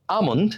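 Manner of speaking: আমন্ড with silent l, "a-mond"; correct pronunciation. Shown as a demonstration. Teacher answering how it should sound, correctly pronounced. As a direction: open "a" and no l in আমন্ড. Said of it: "এর উচ্চারণ কি হবে".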